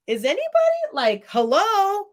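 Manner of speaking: high-pitched, drawn-out disbelief; acted bafflement, pitch jumping up; incredulous. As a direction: call out for someone to back you up, incredulous. Acted disbelief at teacher's remarks.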